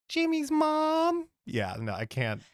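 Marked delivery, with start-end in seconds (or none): 0.1-1.3 put-on very high, slow nerdy kid voice; Carl Wheezer voice. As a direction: drag it out in a squeaky nerd voice. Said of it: "oh, Carl Wheezer".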